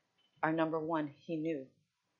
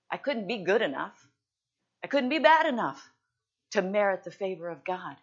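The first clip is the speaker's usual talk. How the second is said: preaching climax; 2 semitones higher, 8 dB louder than usual. Acted.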